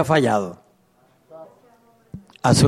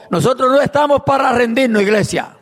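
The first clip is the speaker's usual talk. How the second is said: rousing preaching; 7 semitones higher than usual. Real, not acted. Preacher urging the church never to give up, fervent. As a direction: lift the church up with fire.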